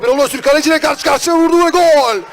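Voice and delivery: high, loud goal shout; excited goal call. Real, not acted. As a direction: call the goal at full pitch. Goal call in match commentary.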